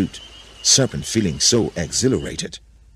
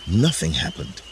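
usual speaking voice

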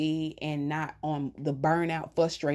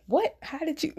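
high squeaky disbelief; retold shock at being found out; mock stunned surprise; 12 semitones higher than usual. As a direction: play the caught-out mom, pitched way up. Mom stunned son guessed visit.

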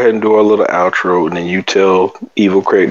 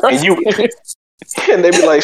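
usual speaking voice

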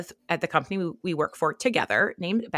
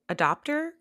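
unsure question; 8 semitones higher than usual. Real, not acted. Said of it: "was an early adapter".

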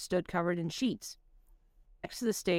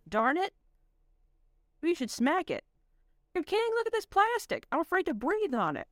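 put-on bright, much higher young woman; chatty tinkering girl, high; high-pitched girl voice; 6 semitones higher than usual. Dolores voiced backstage.

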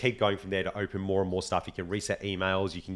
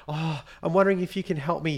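high-pitched imitation of a flustered woman; stressed caller; 7 semitones higher than usual. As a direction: plead for help as a stressed mother. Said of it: "she's creating all this stress".

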